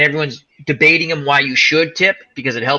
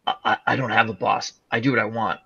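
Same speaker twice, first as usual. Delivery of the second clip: softer and lower, calm and menacing; a quiet threatening killer, unbothered; low menacing psycho voice; 5 semitones lower, 9 dB quieter than usual. Retold or imitated. Retelling the psychopath taunting the cop, low and soft. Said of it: "He goes".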